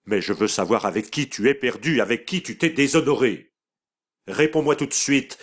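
voix dure qui monte jusqu'au cri; brutale. A narrator's reading. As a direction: jette l'accusation sans pitié. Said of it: "brutalement elle ajouta". (mère brutale qui exige le nom du coupable)

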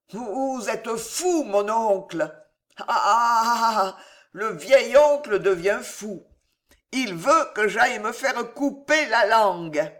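tipsy, incredulous jeering; drunk Gillot. Acted. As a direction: play a drunk scoffing at the uncle. Narrator plays drunk, laughing nephew.

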